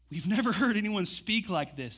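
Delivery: usual speaking voice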